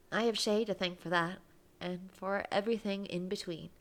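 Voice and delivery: reading a romance game aloud, lightly voiced; expressive read-aloud of a love story. A narrator's reading. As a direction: narrate the scene with gentle feeling. Reads visual novel aloud.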